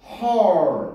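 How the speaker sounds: her의 모음을 길게 늘여서; her, '허-ㄹ' 하고 끌기. Shown as a demonstration. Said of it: "점 있으면 길게". (her를 길게 뽑아서)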